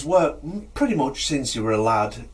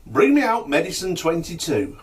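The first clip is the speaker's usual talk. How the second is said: higher-pitched order to an assistant; calling out; 6 semitones higher, 3 dB louder than usual. Acted. Healer orders medicine from his assistant, calling out. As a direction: call the order through to the back. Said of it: "he shouts in the back to his assistant".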